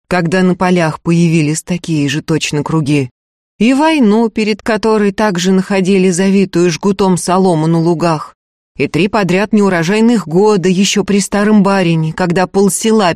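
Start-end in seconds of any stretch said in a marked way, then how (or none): none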